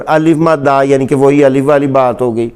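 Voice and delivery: ordinary talk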